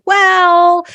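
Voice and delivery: high, loud doubting "Well"; skeptical "well". Retold or imitated. Inner voice doubting a gut feeling, high and loud.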